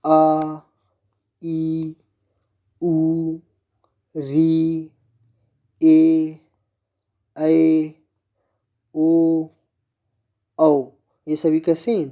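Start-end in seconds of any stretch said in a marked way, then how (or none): 0.0-9.5 each vowel आ, ई, ए held for two beats; long vowels drawn out. Shown as a demonstration. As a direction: draw every vowel out evenly for the class. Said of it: "द्विमात्रिक स्वर".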